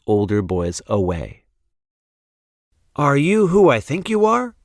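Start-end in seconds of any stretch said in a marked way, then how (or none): 2.9-4.5 a little boy's higher voice, lightly voiced; light boy voice, hopeful question; Chester asking. A narrator's reading. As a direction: ask the cat, hopeful and higher. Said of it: "Chester asked the cat".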